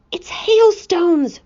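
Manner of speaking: slow, high, thrilled discovery; excited whisper. Acted. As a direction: announce it in hushed excitement. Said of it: "whispered James excitedly".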